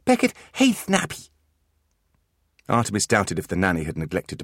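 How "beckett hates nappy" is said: put-on high little-boy voice; toddler voice. Beckett protests to Artemis in a high toddler voice. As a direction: sound like a small stubborn child.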